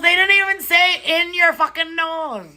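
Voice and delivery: high, incredulous rant; outraged disbelief. Real, not acted. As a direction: sputter in baffled outrage. Outraged at the comment.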